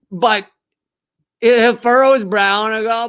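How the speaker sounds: ordinary delivery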